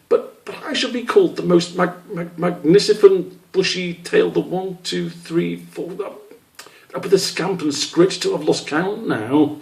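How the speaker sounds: high, stumbling, slowing as he loses count; a confused little kid counting on his fingers; flustered young squirrel. Acted. Squish trying to work out his own long name, flustered.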